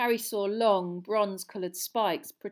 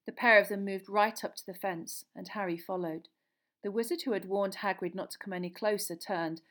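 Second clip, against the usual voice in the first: plain reading voice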